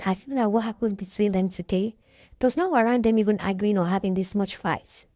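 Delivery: ordinary talk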